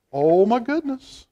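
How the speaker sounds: ordinary delivery, nothing marked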